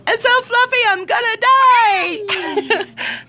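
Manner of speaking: put-on high squealy little-girl gush; imitating an overexcited little girl; little girl movie quote. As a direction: gush like the fluffy-unicorn kid. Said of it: "That's what the little girl says in the movie".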